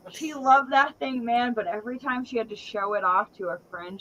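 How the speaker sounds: ordinary delivery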